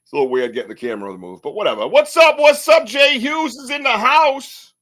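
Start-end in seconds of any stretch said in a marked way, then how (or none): 1.9-4.7 big, high, drawn-out hype shout; hype welcome. Real, not acted. Host loudly welcomes Jay Hughes arriving in the chat, hyped announcer shout. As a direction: announce him like he's hitting the stage.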